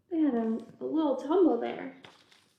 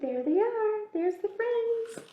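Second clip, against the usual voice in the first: higher, slow, drawn-out delight; excited spotting; 9 semitones higher, slower than usual. Acted. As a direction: point them out with slow glee. Bug spots friends excitedly.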